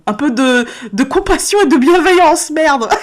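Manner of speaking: half-joking cry for some slack; playful exasperated outburst. Real, not acted. Comic outburst pleading her own case.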